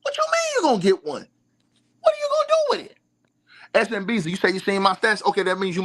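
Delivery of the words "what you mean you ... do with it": squeaky mocking disbelief, way up high; incredulous high-pitched mock. Mocking the fans who want the doll, squeaky incredulous voice. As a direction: throw it back at them in a high incredulous voice.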